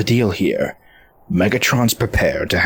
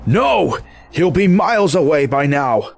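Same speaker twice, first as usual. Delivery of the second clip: alarmed outcry; 8 semitones higher than usual. Acted. Prisoner found missing, alarm.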